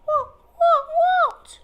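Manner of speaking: high startled squeak; squeaky mouse. Acted. Sleepy mouse jolted awake, squeaking high and confused. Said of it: "she squeaked".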